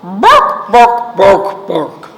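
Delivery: loud woofs dropping in pitch; mock doggy woofing; dog bark. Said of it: "And the dog says".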